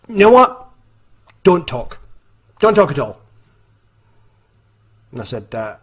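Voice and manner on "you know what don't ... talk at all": bossy order. Retold or imitated. Boss orders silence.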